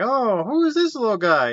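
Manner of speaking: high-pitched greeting for a little dog; retold cooing. Retelling a girl meeting a little guy, high cooing. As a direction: put on a high, delighted coo.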